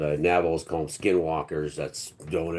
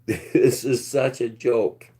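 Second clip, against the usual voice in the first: slow words laughed through; laughing; 4 semitones higher, 3 dB louder, much slower than usual. Real, not acted. Telling the late doctor this place is a joke, laughing. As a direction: chuckle it out slowly.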